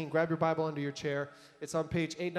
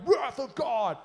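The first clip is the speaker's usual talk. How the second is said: much higher, hammed-up booming declaration; mock thunderous wrath; 8 semitones higher, 4 dB louder, slower than usual. Preacher mocking the idea of sudden fury, hammed-up boom. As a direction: ham up an instant divine outburst. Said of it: "it's not this moment where it's like".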